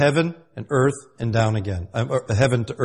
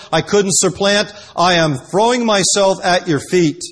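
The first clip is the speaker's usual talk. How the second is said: raised, loud, urgent pleading; insistent plea; 5 semitones higher, 5 dB louder than usual. Acted. Jacob throwing himself at God's feet, insistent loud plea. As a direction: plead at full force, pitch held high.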